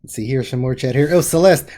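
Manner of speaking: ordinary delivery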